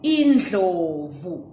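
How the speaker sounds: indlovu said slowly, n-dhl-o-vu with lateral dl; slow teaching pronunciation; careful Ndebele word. Shown as a demonstration. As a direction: spell out indlovu slow and clear. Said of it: "teach you how to pronounce the word".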